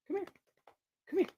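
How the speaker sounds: ordinary talk, nothing marked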